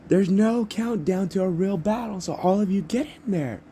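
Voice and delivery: very high, loud hype-man announcer; excited high-pitched announcement; President Mike. Acted. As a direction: send the crowd charging in. President Mike starts the exam.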